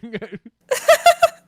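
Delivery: a burst of loud, high laughter. Real, not acted. Laughing loudly at the call's lag.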